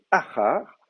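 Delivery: "achar" drawn out slowly, stressed on the first a; first-syllable stress. Shown as a demonstration. Teacher reading it as a segolate, "achar" stressed on its first syllable. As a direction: put the accent on the opening vowel of "achar". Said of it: "c'est la première, euh, voyelle qui est accentuée".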